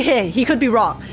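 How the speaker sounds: normal talking voice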